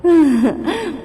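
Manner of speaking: short wicked witch cackle; sinister burst of laughter; evil laugh. Acted. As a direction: let out a gloating villain laugh. Witch gloats again.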